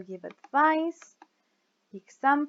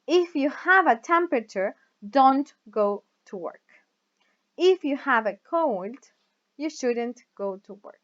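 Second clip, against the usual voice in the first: "If" and "don't" punched louder; stress on IF and DON'T; 5 dB louder than usual. Shown as a demonstration. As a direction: hit "If" and "don't" hard, rest light. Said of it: "you usually stress the words if, don't, and shouldn't".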